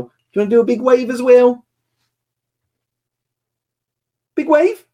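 high, lilting parent question; encouraging daddy voice, high. Real, not acted. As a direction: urge a little one on, pitched right up. Coaxing child into big wave.

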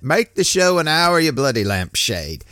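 a badly faked imitation of Sven's accent, loud at the start; a half-hearted mock of Sven's accent, bursting out loud. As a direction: ham up Sven's accent and let it slip by the end. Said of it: "I, I can't sound like Sven".

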